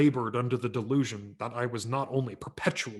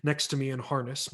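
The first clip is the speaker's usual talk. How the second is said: ordinary delivery, nothing marked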